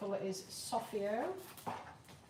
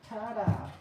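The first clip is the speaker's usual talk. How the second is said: ta-da reveal; 2 semitones higher, 7 dB louder than usual. Real, not acted. Reveal of painted result.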